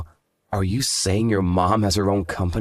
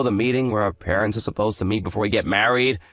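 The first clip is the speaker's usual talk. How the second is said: ordinary delivery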